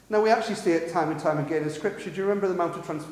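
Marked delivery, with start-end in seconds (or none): none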